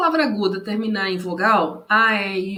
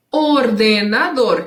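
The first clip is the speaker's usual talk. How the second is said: slow, louder or-de-na-dor; ordenador split in syllables; 6 dB louder, slower than usual. Shown as a demonstration. Teacher counting four syllables, ordenador syllable by syllable. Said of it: "quatro sílabas".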